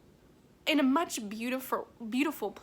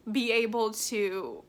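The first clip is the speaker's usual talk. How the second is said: usual speaking voice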